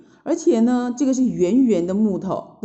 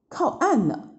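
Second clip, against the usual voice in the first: plain reading voice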